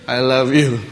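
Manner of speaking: low, gentle I love you; tender love. Pretend. Jesus on the cross gently telling a mocker he loves him. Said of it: "Jesus looked at this fellow and say".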